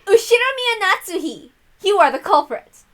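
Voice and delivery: high, loud triumphant denunciation; detective unmasking the killer; dramatic accusation. Acted. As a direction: point and name the culprit, pitch soaring.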